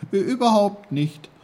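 put-on high, loud boy's protest; high-pitched, put-out complaint; Peter, protesting. A narrator's reading. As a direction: object indignantly in a young voice.

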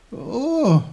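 high, loud drawn-out oh; startled little-boy voice; waking boy Peter. A narrator's reading. Peter roused from sleep by his uncle, high and startled.

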